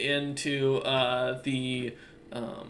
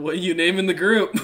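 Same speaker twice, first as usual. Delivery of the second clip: laughing; 5 semitones higher, 10 dB louder than usual. Real, not acted. GM laughing while asking the player.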